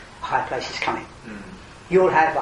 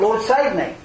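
higher, louder cry for help; calling out; 7 semitones higher, 5 dB louder than usual. Retold or imitated. Preacher voicing Peter calling to the Lord, raised cry. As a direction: cry out to be rescued. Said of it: "Peter called out".

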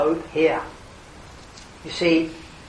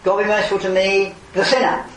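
louder, higher plea for mercy; pleading sinner; 5 semitones higher, 6 dB louder than usual. Retold or imitated. Preacher voicing the man at the temple gate, raised plea. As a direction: cry out for mercy from the heart. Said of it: "that man at the temple gate".

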